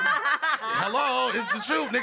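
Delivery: loud laughing brag. Real, not acted. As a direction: boast through laughter, loudly.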